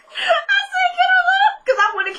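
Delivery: very high, squealing through laughter; laughing hard. Real, not acted. Host cracking up over the retold fight, real high-pitched laughing. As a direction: crack up while trying to talk.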